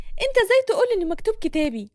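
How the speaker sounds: high, fast, furious demand; angry woman. Acted. Hana bursts into Reda's office, high angry demand. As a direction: storm in accusing him, voice up high. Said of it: "عقد حاجبها بغضب وبتقول".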